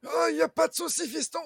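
put-on high, loud cocky old man; old boatman voice. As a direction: bluster like a proud old sailor.

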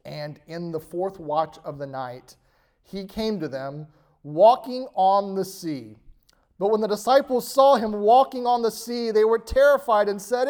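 scripture reading. Acted. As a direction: read it building the tension of the storm.

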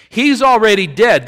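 high, loud imitation of panic; panicked insistence. Angie to Kim in the car, panicked insistence. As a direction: act out a frantic argument for fleeing. Angie arguing to flee, retold.

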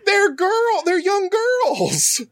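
high, very slow squeak; mock chipmunk squeak; squeaky high voice. Mocking high chipmunk pitch.

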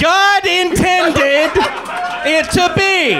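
slow, high victory shout; triumphant proclamation. Acted. Triumphant war declaration.

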